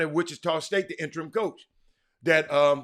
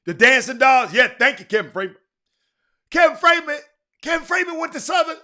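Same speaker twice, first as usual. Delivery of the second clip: excited shout; 8 semitones higher, 8 dB louder than usual. Real, not acted. Hyping Southern band excitedly.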